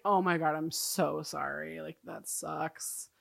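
retold soft, slow, crushed sympathy. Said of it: "is crushed".

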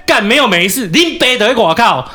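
yelling at the silent neighbour to come out; roaring at neighbour. Retold or imitated. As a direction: bellow it through the door. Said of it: "然后大吼".

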